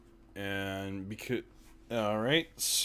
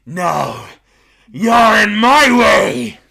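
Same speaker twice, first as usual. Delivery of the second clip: demonic voice, much higher and louder; possessed; 11 semitones higher, 16 dB louder, much slower than usual. Acted. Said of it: "in the creepy, like, demonic voice".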